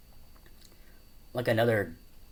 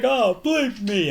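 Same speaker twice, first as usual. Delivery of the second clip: slow, much higher and louder through laughter; laughing mock line; 14 semitones higher, 9 dB louder, slower than usual. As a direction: squeak it out while cracking up. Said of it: "He's like".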